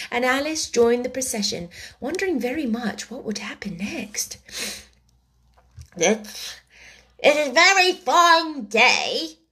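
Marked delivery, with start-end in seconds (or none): 6.0-9.4 halting, high, drawn-out small talk; timid. A narrator's reading. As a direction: stammer nervously, voice raised. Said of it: "said a timid voice".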